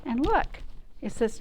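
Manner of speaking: ordinary delivery, nothing marked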